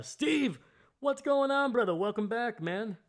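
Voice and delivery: high, bright, loud shout-out; excited welcome. Real, not acted. Streamer greeting a returning viewer, high excited welcome. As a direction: throw a big welcome back.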